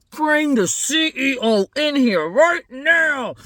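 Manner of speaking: high, slow, emphatic furious order; worked-up demand. Acted. Worked-up family demands the zoo boss, outraged. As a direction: draw out each word, outraged. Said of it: "they're so worked up".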